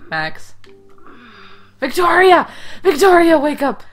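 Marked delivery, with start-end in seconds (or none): 1.8-3.8 high, loud cry to wake someone; loud, high-pitched calling out; urgent shout. Pretend. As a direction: yell it out to rouse an unconscious friend. Calling to unconscious game character.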